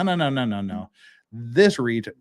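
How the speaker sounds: ordinary talk, nothing marked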